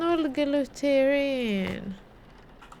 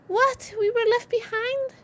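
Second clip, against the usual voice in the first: sharp gasp then high what; shocked gasp; 9 semitones higher than usual. Real, not acted. Shocked by text.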